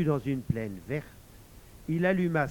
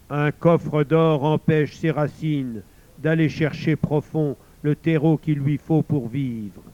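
loud, booming divine voice; voice of God; 8 dB louder than usual. Acted. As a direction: boom down from the heavens. God answers.

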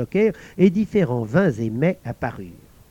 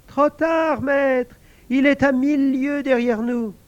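high, pleased voice; a lonely spirit delighted at last; invisible servant exclaiming; 10 semitones higher than usual. Acted. Servant thanking the guard, high excited voice.

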